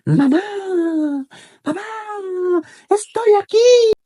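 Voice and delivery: high, stretched cry for mother; acted lost boy's shrieky call; child calling out. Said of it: "comenzó a gritar".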